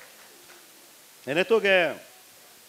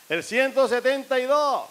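high, loud, slow raffle call; drawn-out announcer shout; 9 semitones higher, 5 dB louder, slower than usual. Acted. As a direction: call the number out again over the crowd. Raffle announcer calls again louder.

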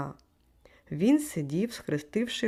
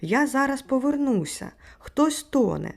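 high, louder urgent call; raised narrator's cry of alarm; shouting; 6 semitones higher, 5 dB louder than usual. A narrator's reading. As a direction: shout back while hurrying off. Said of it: "кричав він".

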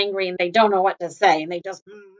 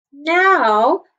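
ordinary delivery

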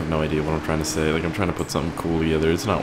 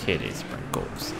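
low, soft, drawn-out impression attempt; hushed, slow and deep put-on voice; Morgan Freeman; 6 semitones lower, 8 dB quieter, slower than usual. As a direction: try the deep celebrity voice, slowly. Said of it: "I can't do it".

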